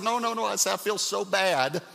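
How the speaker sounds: high, pleading protest; pleading husband. Retold or imitated. Husband pleads with hurting wife, high pleading voice. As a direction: beg her not to send you away.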